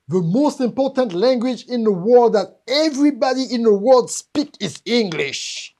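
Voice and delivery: loud, emphatic mimicry of teachers; mock teachers' proclamation. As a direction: proclaim it like an overconfident teacher, drawing out the end. Mimicking teachers' false claim.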